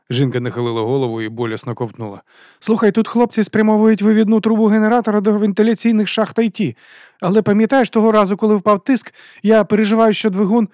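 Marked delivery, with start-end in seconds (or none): 2.6-10.7 high, loud, worried woman; anxious Sherly. Acted. Sherly tells Juliette about the exhaust pipe plan, anxious. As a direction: press the worry urgently in a high voice.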